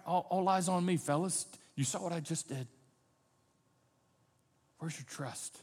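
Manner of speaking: put-on calm frightened boys tenderly like a dad; loving father. Shown as a demonstration. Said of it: "a loving father would say".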